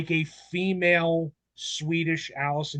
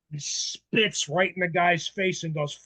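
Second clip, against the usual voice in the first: ordinary delivery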